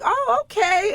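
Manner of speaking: high, rising mock surprise; surprised oh okay. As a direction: act caught off guard, pleasantly. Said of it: "And I was like".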